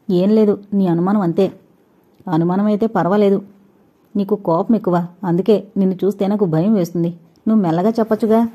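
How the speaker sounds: ordinary delivery, nothing marked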